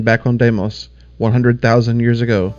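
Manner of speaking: normal talking voice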